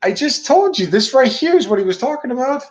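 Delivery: exasperated insistence. Real, not acted. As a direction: push the point, voice climbing. Heated argument with cohost.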